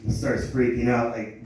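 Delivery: ordinary delivery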